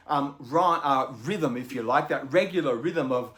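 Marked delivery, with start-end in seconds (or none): none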